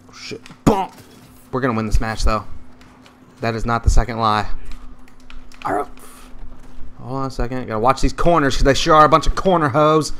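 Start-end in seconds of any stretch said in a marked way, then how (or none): none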